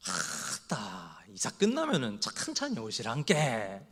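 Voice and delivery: put-on slow drawn-out 아따 in Jeolla accent; lazy Jeolla drawl, easing into it; Jeolla dialect. Pastor playing a Jeolla believer, slow Jeolla dialect. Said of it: "전라도 신자는 이렇게 얘기한대요".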